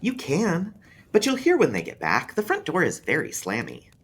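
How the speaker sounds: put-on a kid stating the obvious; young boy, knowing; small boy. A narrator's reading. Boy answers about sister.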